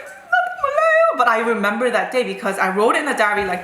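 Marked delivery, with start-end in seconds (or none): 0.0-1.2 very high, hurried mock whine; whimpering pupil. Pupil telling teacher she doesn't know, high imitated whimper. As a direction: squeak it out like a cornered child.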